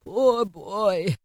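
drawn-out, tender pity; touched, sorrowful murmur. A narrator's reading. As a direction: sigh it in sympathy for a lonely child. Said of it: "he said in pity for his former self".

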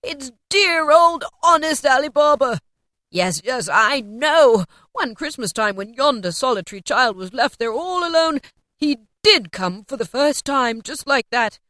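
loud, delighted outburst, settling as it goes; excited, emphatic storytelling in character; ecstatic exclamation. A narrator's reading. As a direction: exclaim in rapture at an old friend. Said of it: "Scrooge exclaimed in ecstasy".

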